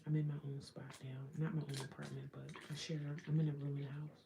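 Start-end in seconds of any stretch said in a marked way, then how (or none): 3.3-4.2 full voiced, louder statement of where she lives; stated out loud. Real, not acted. Telling the stream where she lives, said out loud. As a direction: say it out plainly and strong.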